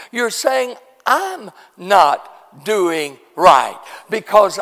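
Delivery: soft, slow and weighty, with pauses; measured pulpit gravity; hushed, deliberate preaching. Real, not acted. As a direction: bring the point home quietly, phrase by phrase. Hushed, slow preaching after the shout.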